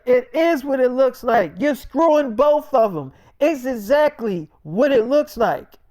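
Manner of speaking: high, emphatic acted outrage; angry insistence. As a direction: shut down a cheater's excuse. Girlfriend rejects his excuse.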